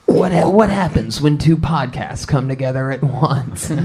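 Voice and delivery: hammed-up movie trailer voice. Podcast host pitching two podcasts colliding, movie voice. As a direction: tease it like a film trailer. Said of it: "movie voice guy".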